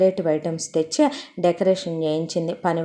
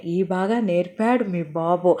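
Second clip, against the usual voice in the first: teasing husband; slower than usual. Acted. Husband teases wife about her father.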